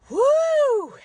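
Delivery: loud high whoop of release; high woo. Real, not acted. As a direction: let out a big woo. Release after heavy talk.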